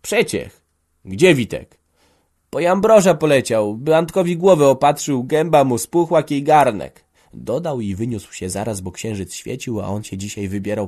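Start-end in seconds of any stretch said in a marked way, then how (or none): 0.0-1.8 a lightly voiced servant calling back; farmhand answering. A narrator's reading. 2.5-7.0 loud and higher servant's voice; farmhand reporting. A narrator's reading.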